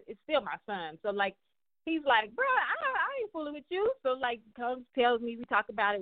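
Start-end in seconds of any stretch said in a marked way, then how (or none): 2.3-3.9 imitating her son. Son retold, quoted to teammate.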